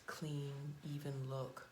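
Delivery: ordinary talk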